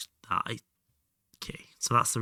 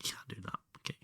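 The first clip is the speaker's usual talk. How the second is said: whispering; 12 dB quieter, much faster than usual. Real, not acted. Whispered aside.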